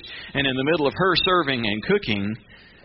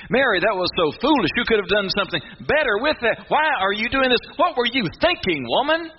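higher and rising, mocking reproach; mock scolding; 12 semitones higher than usual. Guests scolding Mary for pouring perfume, hammed-up higher scolding. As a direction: climb higher on each scolding question. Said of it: "Scolding her".

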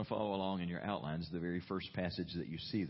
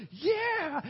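very high, loud cheer; cheering yeah; 9 dB louder than usual. Retold or imitated. People of faith cheering the brave report, loud and high. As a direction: throw up a joyful shout. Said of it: "expecting the people of faith to go".

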